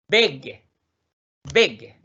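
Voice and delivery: "Beg" said short, vowel cut off quickly. Shown as a demonstration. Teacher answering how it is pronounced, short-vowel "Beg". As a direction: keep the e in "Beg" brief.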